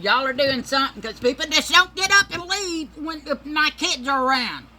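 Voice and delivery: loud, high scolding mother; a mother telling off her kids on the bus, loud and high-pitched; scolding mama. Retold or imitated. Mother scolding her kids on the bus, loud and high.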